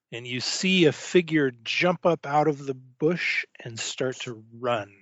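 ordinary delivery

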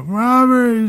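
high wailing cry of a name. Acted. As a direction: wail the name out in grief. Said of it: "wailing and crying".

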